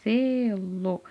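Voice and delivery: "selo" with SE drawn out before LO; first syllable held. Shown as a demonstration. Teacher showing which syllable comes first, "selo" stretched by syllables. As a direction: say SE long, then let LO follow. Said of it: "eu primeiro falo a sílaba".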